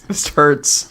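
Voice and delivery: usual speaking voice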